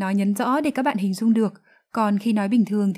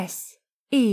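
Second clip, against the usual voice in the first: ordinary delivery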